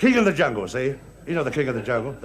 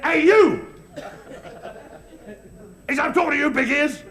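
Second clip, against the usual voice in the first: angry lion shout; 7 semitones higher than usual. Acted. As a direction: pick a fight with someone much bigger. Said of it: "He was really mad".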